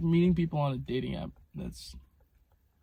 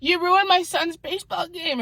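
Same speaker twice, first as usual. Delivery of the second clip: much higher whiny mock voice; mocking the mom; 5 dB louder than usual. Vlogger imitates the mom blaming her, high mock whine. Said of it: "she was like".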